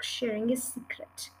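usual speaking voice

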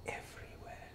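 barely audible; hushed whisper. Real, not acted. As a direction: whisper it as a reverent afterthought. Lingers on the teaching's key word.

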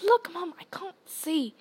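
put-on high little-girl squeal; Ginny Weasley. A narrator's reading. Younger sister begging mom to look, squealing. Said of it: "she squealed".

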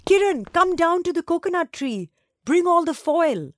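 high, loud call down the corridor. Acted. Girl shouts down the corridor to her friend, calling out loud. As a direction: shout it to a friend below, slowing on the last words.

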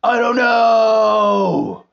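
huge mock wrestler shout; Lex Luger shout. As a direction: bellow it like a hammy wrestler. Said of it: "Lex would be like".

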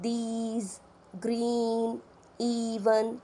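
these, green, even with a long clear ee; slow teacher's model words; long ee demo. Shown as a demonstration. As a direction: model the long vowel in each word. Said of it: "When we say the word".

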